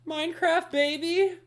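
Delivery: hammed-up lovesick pleading; begging ex-lover. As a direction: beg Minecraft like a lover who left. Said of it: "I'm like".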